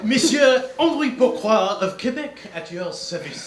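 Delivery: hammy French-Canadian detective; French accent. Acted. Detective greeting fellow passengers, lightly hammed French accent. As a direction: introduce yourself with a grand French flourish. Detective introduces himself in character.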